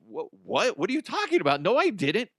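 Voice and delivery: high, stunned protest of innocence; shocked denial. Retold or imitated. Falsely accused man protesting to the police, high and shocked. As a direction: pitch jumps up in disbelief. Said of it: "And I'm like".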